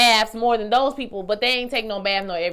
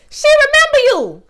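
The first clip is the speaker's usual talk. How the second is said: high squealing realization; 11 dB louder than usual. Retold or imitated. Realizing on screen the mother remembers her, squealing. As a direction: repeat it much higher and louder. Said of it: "I said".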